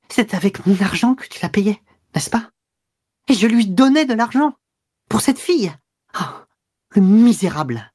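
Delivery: high-pitched, mounting indignation; emportement croissant. A narrator's reading. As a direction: work yourself up into outraged accusation. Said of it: "s'emportant de plus en plus". (indignation aiguë qui s'emporte de plus en plus)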